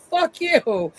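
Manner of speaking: high laughing quote; laughing so hard it spills over. Real, not acted. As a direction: crack up on the line. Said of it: "I was dying. I was laughing".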